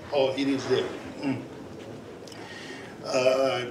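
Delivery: normal talking voice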